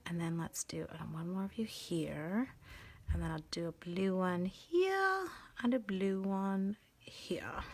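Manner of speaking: slow hushed whisper. Real, not acted. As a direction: whisper it slowly to yourself. Whispered aside while coloring.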